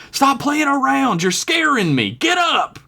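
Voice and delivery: high, frantic pleading; panicked. Acted. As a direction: urge her awake in a panic. Friend panics, shaking her awake.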